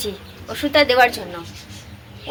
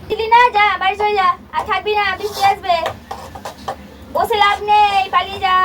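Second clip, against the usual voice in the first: shooing the cat home; 9 semitones higher than usual. Real, not acted.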